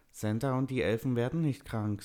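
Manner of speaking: high, mildly sad character voice. A narrator's reading. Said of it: "sagte Marco traurig".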